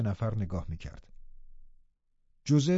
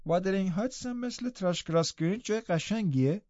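put-on a sickly child asking, a little wistful; frail boy Linton; 7 semitones higher than usual. A narrator's reading. Linton asks about Wuthering Heights.

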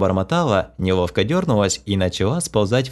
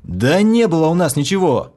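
barked; 7 semitones higher, 3 dB louder than usual. A narrator's reading. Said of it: "рявкнул я".

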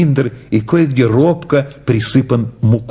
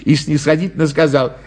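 ordinary delivery

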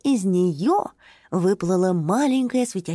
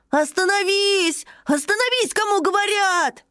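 shouting; 12 semitones higher than usual. Acted. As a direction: yell for it to stop. Ruti shouting at the whirlwind.

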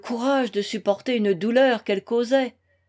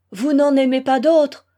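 louder, exasperated repeat of the question; 3 semitones higher, 7 dB louder than usual. A narrator's reading. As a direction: demand it, voice raised in exasperation. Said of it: "répéta Alexis exaspéré". (exaspéré, voix forte)

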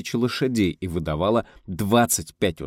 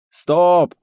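loud, much higher cry; shout; 11 semitones higher, 10 dB louder than usual. A narrator's reading. Director stopping the scene, sudden shout. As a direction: cut the take with one sharp yell.

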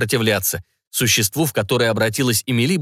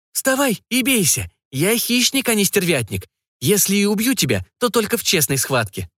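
huntress's challenge; 9 semitones higher than usual. Acted. Huntress challenges vampire to fight.

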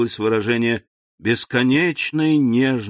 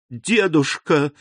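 exclaiming; 5 semitones higher, slower than usual. A narrator's reading. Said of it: "воскликнула она".

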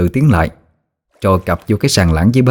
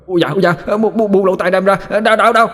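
much higher yelps, rising to the end; yelping in pain; 10 semitones higher than usual. Acted. Imp squeals in pain as his ear is pulled. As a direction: squeal as your ear is twisted.

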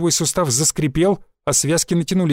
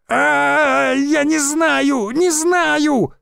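much higher, slow tearful scream; screaming in tears; 11 semitones higher, much slower than usual. A narrator's reading. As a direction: wail it out sobbing in pain. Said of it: "кричал Шульман, обливаясь слезами". (крик сквозь слёзы, намного выше)

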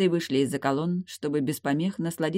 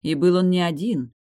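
usual speaking voice

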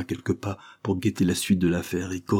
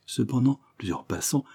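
usual speaking voice

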